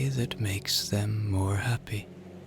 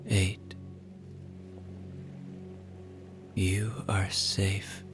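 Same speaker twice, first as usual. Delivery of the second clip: guided relaxation; 8 dB quieter than usual. Acted. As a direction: ease them toward sleep, unhurried.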